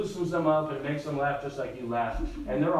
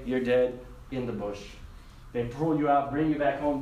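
ordinary delivery

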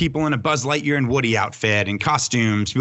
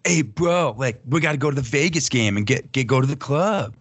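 put-on drunk bro voice; 3 semitones higher than usual. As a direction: slur it like a drunk bro in Vegas. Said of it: "my new drunk guy in Vegas voice".

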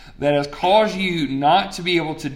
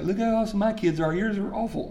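higher-pitched, softer mock brag; mock boasting; 5 semitones higher, 4 dB quieter than usual. Preacher mimicking a parent bragging about the kids, mock boasting. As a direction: toss it off as a hammed-up boast. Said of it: "I'm not trying to think like".

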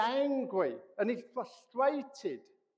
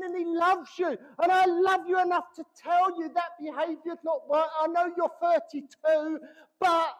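higher, louder parental rebuke; shouting at the kids; 7 semitones higher, 7 dB louder than usual. Retold or imitated. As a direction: tell the kids off with full force. Parent voiced rebuking grown kids.

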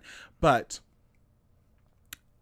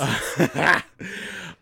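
real burst of laughter; loud laugh; 4 semitones higher, 5 dB louder than usual. Real, not acted. Cracking up over the collage joke, loud laugh. Joking about the collage pictures.

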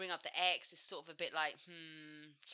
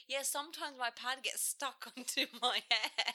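imitating girl; 7 semitones higher than usual. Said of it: "And she was like".

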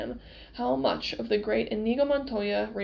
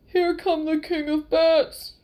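loud, slow panicked scream; terrified scream; 6 semitones higher, 6 dB louder, slower than usual. A narrator's reading. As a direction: scream it in pure terror. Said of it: "Fezzik screamed".

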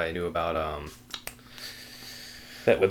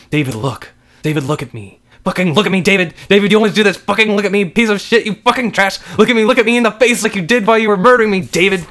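building from raised voice to high, loud shrieking; furious screaming; 13 dB louder than usual. Acted. Murdered wife demands husband face her, furious screaming. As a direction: rage at him without letting up.

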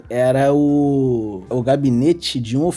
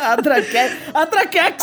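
mock triumph; 11 semitones higher than usual. Real, not acted. Hails the name in mock triumph.

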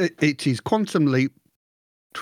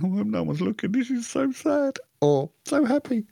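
mock teary sob; 10 semitones higher than usual. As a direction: pretend to be moved to tears while nobody watches. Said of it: "you'd be sitting there going".

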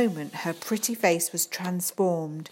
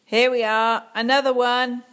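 put-on loud, higher sneering kid; taunting boy; 6 semitones higher, 8 dB louder than usual. Boy mocks Sophie at the sink, loud jeering. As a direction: jeer at her while sloshing the glue.

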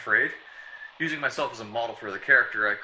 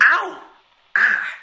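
sharp high loud cries of pain; pained yelps; 13 semitones higher, 11 dB louder than usual. Real, not acted. Writer hurt during rehearsal, real yelps. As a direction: yelp as if struck. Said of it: "so doggone realistic".

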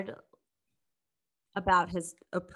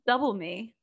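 higher, words broken by laughter; laughing; 6 semitones higher, 3 dB louder than usual. Real, not acted. Host laughing over being half his height, higher. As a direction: giggle through it in disbelief.

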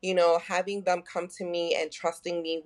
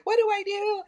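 panicked plea. Retold or imitated. Panicked plea to her therapist.

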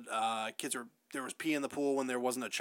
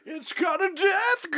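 mock doom prophet; 13 semitones higher, 5 dB louder than usual. Mocking the crazy prophet.

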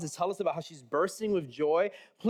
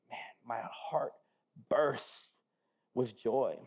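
hushed, breathless whisper of wonder; awed whisper; 7 semitones lower, 6 dB quieter, slower than usual. Acted. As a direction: whisper it like a secret joy. Quoting Mary's song of joy.

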